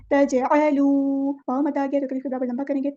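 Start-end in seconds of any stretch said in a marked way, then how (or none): 0.1-0.9 तजअलू with the जीम shaken and echoed; qalqala on jeem. Shown as a demonstration. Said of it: "इसको हिलाना है". (जीम को हिलाकर पढ़ना)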